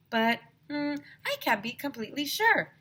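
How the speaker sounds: high, wavering hmm of a puzzled principal; mock-unsure principal musing; lightly voiced hesitant principal; doubtful principal.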